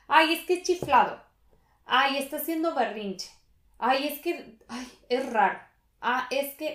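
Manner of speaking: high, scoffing "ay" voice; dismissive mockery. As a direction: brush someone off as a spoiled brat. Mocking how people dismiss anxiety.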